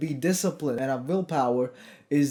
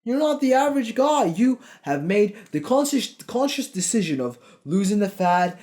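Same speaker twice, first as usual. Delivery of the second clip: raised, higher-pitched rant, swearing hard; fired-up rant; 4 semitones higher than usual. Real, not acted. Coach ranting at viewers who fear their friends' teasing, fired up. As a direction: rip into the excuse-makers. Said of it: "I'm fucking sorry if I'm going off a bit".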